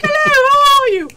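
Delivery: very high, loud hooty falsetto; mock English lady. English woman with baby answers shop girl, mock falsetto gush. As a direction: trill the greeting back, exaggerated.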